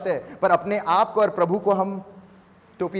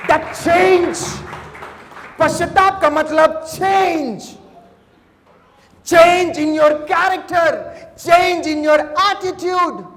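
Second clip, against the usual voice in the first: slow, loud, higher preaching shout, stretching each change; preaching at full force; 8 semitones higher, 6 dB louder, much slower than usual. Acted. Pastor drives home repentance to the church, slow and shouted. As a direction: pound every change at the congregation.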